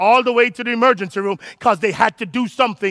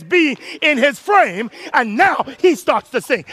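raised pitch, slow punched phrases; dramatic preaching; 4 semitones higher than usual. Real, not acted. Preacher telling how Peter started sinking, dramatic and emphatic. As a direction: draw out the key words for drama.

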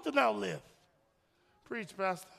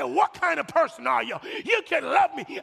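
loud, accusing outburst; frustrated; 4 dB louder than usual. Acted. Hurt partner confronts the one who can't hug them, frustrated. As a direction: demand it in exasperation. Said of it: "they're getting frustrated with you".

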